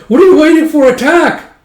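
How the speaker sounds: very high, loud frantic yell; shrieking commander. Acted. Commander yelling at hesitant troops, very high shriek. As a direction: screech at the troops to attack.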